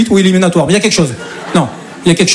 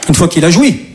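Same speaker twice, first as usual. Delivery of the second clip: ordinary delivery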